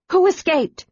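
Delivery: urgent cry. A narrator's reading. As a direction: call out desperately from dragonback. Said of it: "Kit cried".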